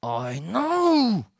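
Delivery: rising irritation. Retold or imitated. Kid pushed by his therapist, getting irritated. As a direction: let irritation swell on KNOW. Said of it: "get a little bit angry".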